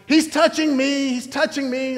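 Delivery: put-on high, whiny kid complaint; whiny tattling little sibling. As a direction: whine like a kid tattling in the car. Kid complaining in back seat.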